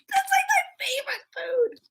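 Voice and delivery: high, soft excited squeal. Real, not acted. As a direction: squeal with delight about the food. Excited over shared love.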